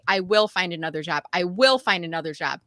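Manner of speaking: "will" hit firmly in each line; stress on will. Shown as a demonstration. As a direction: lean hard on the word "will". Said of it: "Emphasis on the word will".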